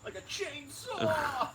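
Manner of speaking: shrieked high, loud and slow like a chainsaw; mock chainsaw shriek. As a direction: howl it out like a revving chainsaw. Mocking passionate outburst.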